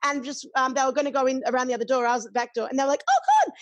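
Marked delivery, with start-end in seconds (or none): 3.1-3.5 a shrill, much higher excited squeal; squealing fans. Retold or imitated. Queens waiting at the back door spotting her, excited squeal. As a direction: squeal like a starstruck fan.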